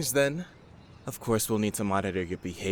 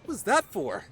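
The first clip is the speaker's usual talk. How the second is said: indignant protest; 10 semitones higher, 3 dB louder than usual. Acted. Protesting being hit.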